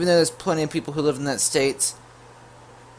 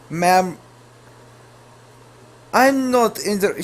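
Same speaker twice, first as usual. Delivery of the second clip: put-on Russian accent; 8 semitones higher, 5 dB louder, slower than usual. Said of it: "continue with the whole Russian thing".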